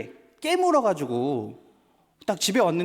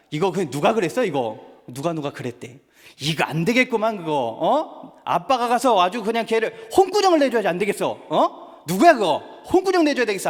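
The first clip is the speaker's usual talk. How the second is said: mock outrage; 2 semitones higher, 4 dB louder than usual. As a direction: ham up a dad ready to go scold the kid. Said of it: "한 번 더 나아갔습니다".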